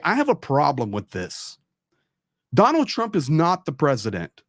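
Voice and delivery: ordinary talk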